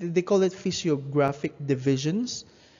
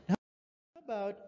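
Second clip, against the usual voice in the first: normal talking voice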